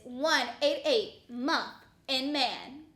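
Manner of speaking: slow, spelled-out number; slow repeat. Acted. Anchor [name] repeats the tip-line number for viewers, slow and deliberate. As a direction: read it out slowly so viewers can write it. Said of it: "And again".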